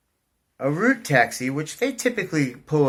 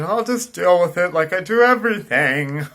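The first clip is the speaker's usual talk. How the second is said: higher and louder, breezy self-mockery; mock carefree; 7 semitones higher, 9 dB louder than usual. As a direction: play your careless past self, lightly. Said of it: "In my mind, I'm thinking".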